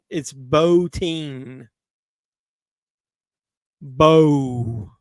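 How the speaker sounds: put-on Botine drawn out slowly as Bo-teen; Bo-teen said slowly with a loud first syllable; careful pronunciation. Shown as a demonstration. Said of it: "I'll say it slow next time".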